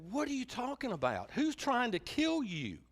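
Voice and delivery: high, alarmed and disbelieving, slowing on the question; king aghast at hearing his queen is threatened; shocked king. Retold or imitated. Preacher voicing the king shocked to learn of a plot, high and alarmed. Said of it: "the king goes".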